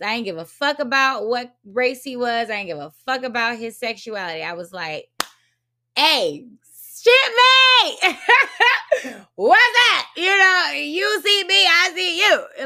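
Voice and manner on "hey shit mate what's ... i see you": loud, high whooping hail with a laugh; excited greeting. Retold or imitated. Greeting a fellow Navy veteran, excited shouting. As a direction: holler like you've found a shipmate.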